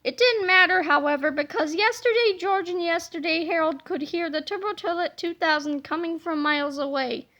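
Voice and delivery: plain reading voice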